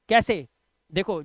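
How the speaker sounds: normal talking voice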